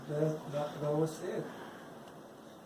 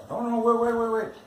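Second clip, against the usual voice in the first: higher-pitched, repeated plea to wait; retold hesitant protest; 6 semitones higher, 4 dB louder, much faster than usual. Convert stalling the pastor's baptism offer, protesting. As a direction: act out halting someone hurriedly.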